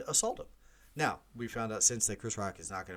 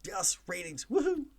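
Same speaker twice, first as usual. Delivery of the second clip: much higher, hammed-up cheer of relief; mock Academy gloating; 12 semitones higher than usual. The Academy thanking the scandal for ratings, mock giddy. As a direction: play the Academy delighted in secret. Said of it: "the Academy in a dark room right now is like".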